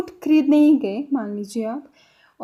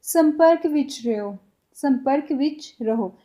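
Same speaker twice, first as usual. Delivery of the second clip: slow teaching demo; slower than usual. Shown as a demonstration. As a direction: say "संपर्क विच रहो" slowly, word by word. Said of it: "Punjabi में कैसे बोलेंगे". (धीरे-धीरे सिखाते हुए)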